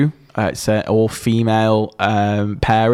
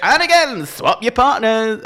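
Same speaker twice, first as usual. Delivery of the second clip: high-pitched, drawn-out dance call; mock the over-excited TV host; hyped show announcer; 10 semitones higher than usual. Podcaster mocks the repeated partner swap, high announcer hype. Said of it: "would have been better had they have said".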